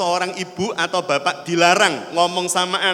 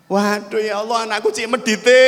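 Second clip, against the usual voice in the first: loud, higher mock lament; disappointed mother; 4 semitones higher, 4 dB louder than usual. Mother laments her stingy child, hammed-up disappointment. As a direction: exclaim in acted maternal dismay. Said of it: "Ibunya kecewa atau nggak".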